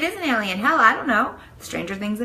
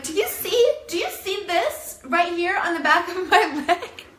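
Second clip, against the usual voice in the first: 1 incredulous pleading; 9 semitones higher than usual. Retold or imitated. Showing the nurse the tick.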